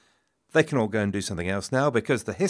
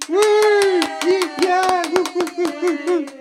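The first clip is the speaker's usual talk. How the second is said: high, loud whooping; excited cheering; 7 dB louder than usual. Real, not acted. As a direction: whoop it up like a crowd. Cheering the draw.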